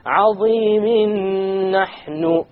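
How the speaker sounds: Azimin nahnu chanted high and very slow, tanween merged into noon with gunna; recited with gunna. Shown as a demonstration. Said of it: "tanween followed by another noon".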